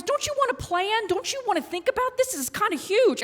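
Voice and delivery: high-pitched worried protest; mock-alarmed string of questions; pleading husband. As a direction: imitate a fretting husband, voice way up.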